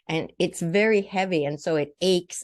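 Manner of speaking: usual speaking voice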